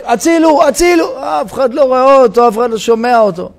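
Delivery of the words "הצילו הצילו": high, rising calls of help; mock shout for rescue from a rooftop; crying for help. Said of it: "צועק". (קריאה לעזרה בקול גבוה)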